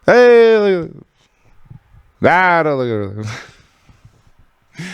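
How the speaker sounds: the media steering eyes away from the real story; mock distraction. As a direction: wave the crowd off like a carnival barker. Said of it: "they're just like".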